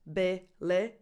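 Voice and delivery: be, le with a short lax I sound; lax vowels. Shown as a demonstration. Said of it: "has two lax I vowels".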